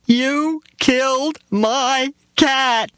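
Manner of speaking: put-on very high, slow wailing little girl; little girl accusing the driver; screaming child. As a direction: wail it like a heartbroken child. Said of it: "she screamed".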